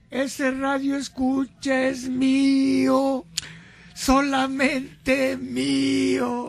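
possessive mock. Broma posesiva, muy lento.

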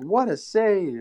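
slow, high announcer call; drawn-out game announcer. Retold or imitated. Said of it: "it's like".

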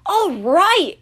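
loud, high angry shout; furious shout at the sky. A narrator's reading. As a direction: yell it up at the thunder. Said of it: "Chiron shouted".

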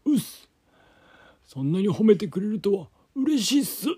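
high, loud hammed-up wrestler; sumo wrestler voice. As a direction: bellow like a cheerful sumo wrestler.

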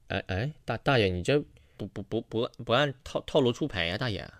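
put-on soft embarrassed stammer to the old man; flustered. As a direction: mumble it, thrown off balance.

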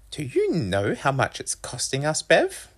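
high-pitched mother voice; guilt-tripping mum. A narrator's reading. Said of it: "successfully making me feel guilty".

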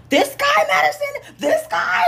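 high, incredulous mock yell; yelling sister. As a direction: imitate an outraged older sister yelling. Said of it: "She's yelling at him".

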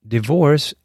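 usual speaking voice

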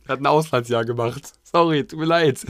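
high, slow hammy apology; hammy drawn-out sorry; mock apology. Acted wife apologizing.

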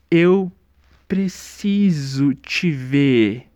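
very slow, spaced-out delivery; dazed mock intonation. Shown as a demonstration. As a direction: say it like you just took a rock to the head. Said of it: "você tem que falar nessa entonação, parecendo que você acabou de tomar uma pedrada". (entonação lenta e aérea, como quem levou uma pedrada)

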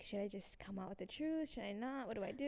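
hushed retold indecision; inner voice. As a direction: fret quietly to yourself. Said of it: "in my head, I'm thinking".

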